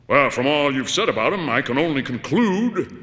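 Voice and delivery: a loud stranger's voice, slowing at the end; loud voice on tape. Acted. Stranger on the tape, loud. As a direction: boom it out like a taped message. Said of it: "talk in this loud voice".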